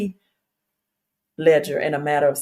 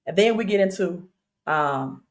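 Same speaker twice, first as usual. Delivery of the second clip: ordinary talk, nothing marked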